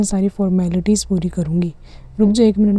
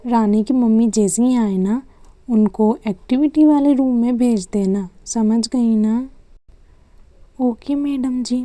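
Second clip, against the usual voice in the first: normal talking voice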